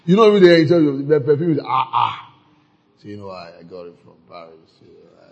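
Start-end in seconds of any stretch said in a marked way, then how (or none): 3.0-5.2 mock murmur. Mimics a vain churchgoer murmuring.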